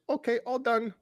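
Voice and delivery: high nurse voice announcing done; mock Vietnamese accent. Patient imitating the nurse finishing the draw in accent. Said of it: "And she goes".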